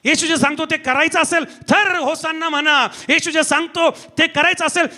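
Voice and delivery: relentless, building sermon cadence; impassioned preacher crying out to the congregation; full-force preaching. Acted. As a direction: pile up each phrase with rising urgency.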